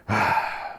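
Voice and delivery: acted weary sigh. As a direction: let out a long, soft breath. Acted speech, exhausted by the mask.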